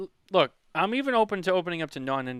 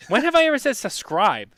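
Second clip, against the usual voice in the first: incredulous; 9 semitones higher, 10 dB louder than usual. Real, not acted. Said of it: "That doesn't even make sense".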